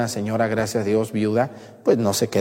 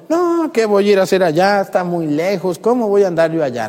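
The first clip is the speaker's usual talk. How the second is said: higher-pitched acted grumbling refusal; put-on complaint, pitched up; mock reluctant protest; 7 semitones higher than usual. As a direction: balk at it like someone dodging a chore. Imagined balky refusal, acted out.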